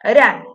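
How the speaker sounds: "Ran" punched loud on its own; strong tonic syllable. Shown as a demonstration. Teacher naming the syllable that sounds strong, "Ran" stressed loudly. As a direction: hit "Ran" hard as an isolated stressed syllable. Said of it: "es la que suena fuerte".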